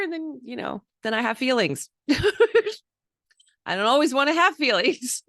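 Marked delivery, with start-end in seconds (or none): 2.1-2.8 laugh. Real, not acted. Laughing about having feelings.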